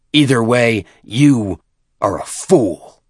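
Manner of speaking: loud, slow and heavy with scorn; a nephew calling his chieftain a fool, with contempt; contemptuous insult. Acted. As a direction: lean into every word of the insult.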